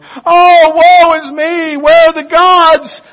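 high, loud, hammed-up cry of woe; mock wailing. Worrying believer mocked before lost neighbors, wailing high and loud. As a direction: wail it like a melodramatic mourner. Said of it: "worry out loud".

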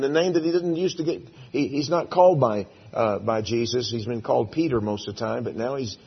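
ordinary delivery

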